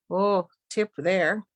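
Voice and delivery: ordinary talk, nothing marked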